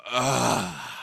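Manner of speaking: long exasperated "ugh"; drawn-out groan of exasperation; exasperated group groan. Acted. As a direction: groan like everyone is fed up. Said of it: "a number of voices just go".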